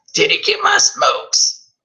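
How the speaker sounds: high, scratchy calling voice; witchy old mother. Acted. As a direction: screech it down the stairs.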